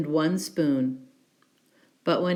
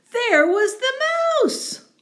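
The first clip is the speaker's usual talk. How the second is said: much higher, louder reveal of the mouse; surprised reveal; 5 dB louder than usual. A narrator's reading. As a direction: gasp it out, the mouse again.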